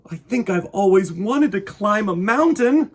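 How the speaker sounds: a toy bear dreaming of mountains; excited bear. Acted. Corduroy marvels on escalator.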